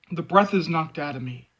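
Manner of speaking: ordinary delivery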